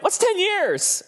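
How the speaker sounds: very high, slow, dismissive; God shrugging. Retold or imitated. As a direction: wave it off like ten years is nothing. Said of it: "he's like".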